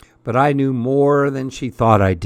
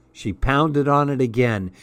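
plain reading voice

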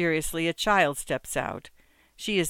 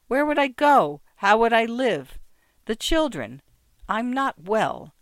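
high, strained, pleading louder; desperate pleading; 7 semitones higher, 5 dB louder than usual. A narrator's reading. Battered wife pleading with her friend, desperate. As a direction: beg in rising panic.

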